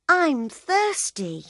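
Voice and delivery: put-on high, slow whining child; whiny little boy. A narrator's reading. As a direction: whine it like a thirsty kid.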